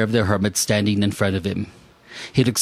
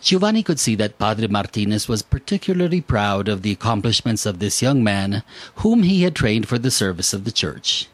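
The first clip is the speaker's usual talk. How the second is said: ordinary delivery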